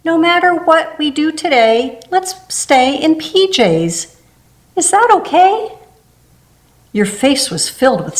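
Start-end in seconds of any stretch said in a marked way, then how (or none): 0.0-5.7 lightly voiced little girl, rising to a high hopeful ask; a hint of a small daughter asking sweetly; child's request. A narrator's reading. Little daughter begging to stay in PJs, lightly childlike. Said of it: "you woke up and said a funny".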